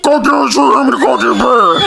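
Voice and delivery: mush-mouthed mock of dad; hammed-up incomprehensible dad voice; imitating garbled dad. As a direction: garble it into unintelligible mumbling. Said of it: "talking in your fat fucking whispers".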